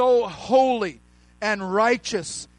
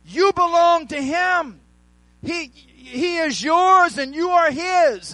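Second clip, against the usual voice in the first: raised, ringing preacher's voice, shouting the key words; full preaching; 3 semitones higher than usual. Acted. Pastor preaching to his church, at full force. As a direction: preach it hard to the congregation.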